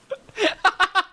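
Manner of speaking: high, loud real laugh; sudden loud laughing fit; burst of laughter. Real, not acted. Streamer cracking up at a chat message, real laughter.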